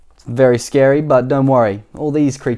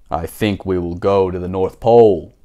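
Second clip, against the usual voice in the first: lower, measured grown-up voice; Mister Daydream's voice; 6 semitones lower than usual. Shown as a demonstration. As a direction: say it the way he would, lower. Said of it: "is how he would say it".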